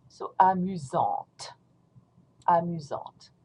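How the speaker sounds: slow amusante with the final T sounded; drawn-out amusante ending on a crisp T; pronounced T. Shown as a demonstration. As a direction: feminine amusante, T heard. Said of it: "you're going to pronounce a T".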